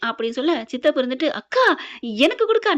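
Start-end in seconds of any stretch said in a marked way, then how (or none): 1.3-2.7 high-pitched, eager mock plea; much higher voice, playfully wheedling; eager pleading. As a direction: act out begging a sister for a share. Acted uncle eagerly begging for snacks.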